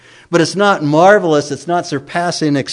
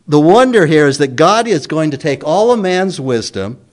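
ordinary delivery

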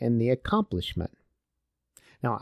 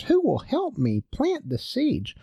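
little red hen; 12 semitones higher than usual. Acted. Hen asks her friends.

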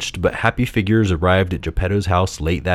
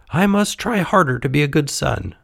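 high, earnest puppet voice; 8 semitones higher than usual. A narrator's reading. Puppet resolves to improve.

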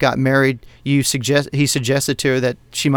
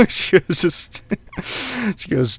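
normal talking voice

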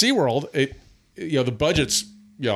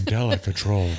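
low, polished ad read; marketing voice; 5 semitones lower than usual. Acted. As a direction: say the brand like a commercial. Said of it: "That's a marketing voice".

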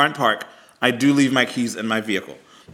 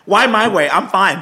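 higher, louder impatient retort; retold exasperation; 7 semitones higher, 5 dB louder than usual. Caller objecting to police being sent her way, retold exasperation. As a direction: push back at the dispatcher, exasperated.

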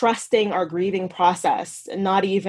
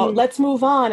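higher, louder sarcastic brush-off; mock impatience; 5 semitones higher, 4 dB louder than usual. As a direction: mimic the world rushing you along. Voicing society's impatience with grief.

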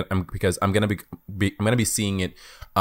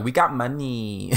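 slow, smug, higher boast; smug rich studio; 6 semitones higher, much slower than usual. Retold or imitated. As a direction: drawl it like a rich exec. Voicing Disney boasting.

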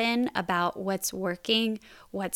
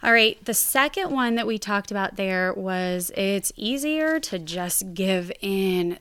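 ordinary talk, nothing marked

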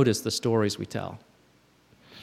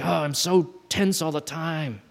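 high, drawn-out mock moan; hammed-up weary whine; mock complaint; 4 semitones higher, slower than usual. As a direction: play up the self-pity, slowly. Voices the old tense story.

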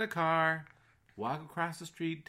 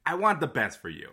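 ordinary talk

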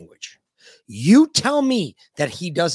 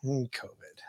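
hushed, voiceless murmur; whispered; 12 dB quieter than usual. Pretend. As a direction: whisper it under your breath. Mock hushed echo of covid.

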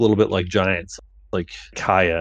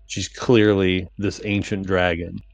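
normal talking voice

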